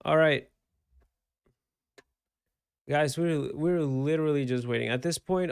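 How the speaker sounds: rally the viewers, full energy; loud excited call. Real, not acted. Restless streamer rallying waiting viewers.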